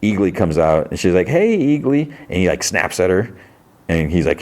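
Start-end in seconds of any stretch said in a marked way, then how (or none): 1.3-2.1 high, sing-out hello to a pet; cooing greeting. Retold or imitated. She greets the pet eagle, cooing greeting. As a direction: imitate someone greeting an animal sweetly.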